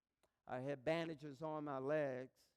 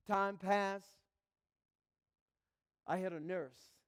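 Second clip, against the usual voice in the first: normal talking voice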